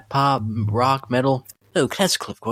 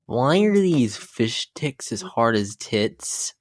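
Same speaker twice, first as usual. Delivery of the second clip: normal talking voice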